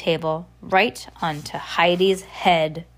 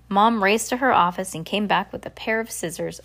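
plain reading voice

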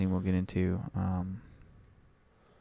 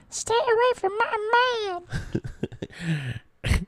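very high, slow dolphin squeal; jealous dolphin Sissy; 5 dB quieter, much slower than usual. Acted. Sissy warning off a rival, high squealing dolphin voice. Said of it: "And Sissy goes".

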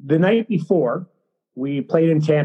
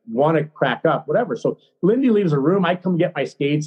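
ordinary talk

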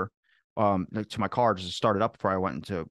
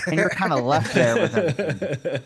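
laughing through the words, louder; 7 semitones higher, 5 dB louder than usual. Real, not acted. Finishing his car door joke, laughing through it. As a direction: let the laugh break the punchline.

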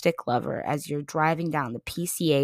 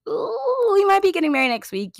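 excited squeal; 5 dB louder than usual. Real, not acted. Gushing about meeting the artists.

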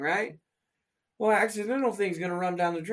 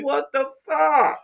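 mock disbelief; 6 semitones higher, 4 dB louder, slower than usual. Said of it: "I'm like".

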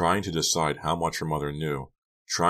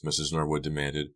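ordinary delivery, nothing marked

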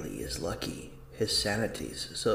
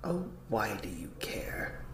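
eerie whisper; 7 dB quieter than usual. Acted. Unseen voice answers from nowhere.

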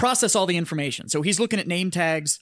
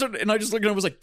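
ordinary talk

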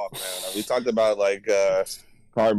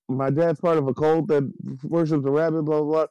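higher, panicky retold plea; mock panic; 6 semitones higher than usual. Stan panics to Kyle at his door, mock panic. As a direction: rush it out like a freaked-out kid. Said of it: "like, panicking".

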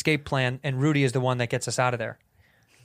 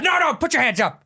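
high, loud barked order; robber's shout; 12 semitones higher, 5 dB louder than usual. Acted. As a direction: shout a hammed-up hold-up command. Acting out an armed robbery.

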